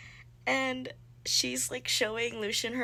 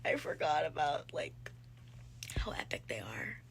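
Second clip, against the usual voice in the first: awed whisper; 6 semitones lower, 6 dB quieter than usual. Real, not acted. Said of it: "Oh my god".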